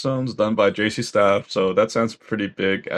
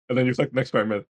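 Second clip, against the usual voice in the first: usual speaking voice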